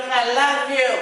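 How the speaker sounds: high gushing tipsy "I love you"; sloppy drunk affection; happy drunk. Retold or imitated. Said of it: "I'm one of them happy drunks".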